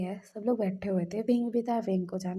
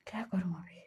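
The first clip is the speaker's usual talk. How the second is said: whispered thought to himself; hushed private worry; inner thought; 3 semitones lower, 4 dB quieter than usual. Retold or imitated. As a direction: murmur it under your breath, as if thinking. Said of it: "बोल रहा था मन में".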